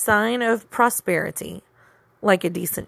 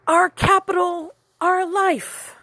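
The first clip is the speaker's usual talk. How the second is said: high squeaky grandmother voice; squeaky old woman; 11 semitones higher than usual. Acted. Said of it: "His grandmother's voice squeaked out".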